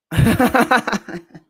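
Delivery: high, loud burst of laughter; loud laughing. Real, not acted. Host cracking up at listeners' reaction, loud laughter. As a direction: crack up in a long run of ha-has.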